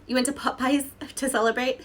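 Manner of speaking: laughing through the question; amused, breaking into laughter. Real, not acted. Rereading the comment, laughing.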